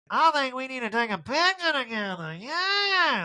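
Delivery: high, gushing mock voice; Erica. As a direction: gush it high with a long-drawn ending. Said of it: "Erica's like".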